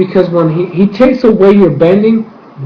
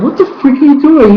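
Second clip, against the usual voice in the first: outraged disbelief; 10 semitones higher than usual. Real, not acted. Viewer reacting to the father yelling.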